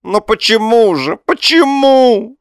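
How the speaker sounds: very high, loud wail, stretching the last word; melodramatic, anguished plea; bovine passion. A narrator's reading. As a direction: bellow it out like a lovesick cow. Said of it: "сказал Лоханкин с коровьей страстностью".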